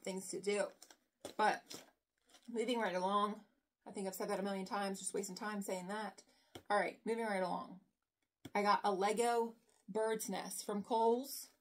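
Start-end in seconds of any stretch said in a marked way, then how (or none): none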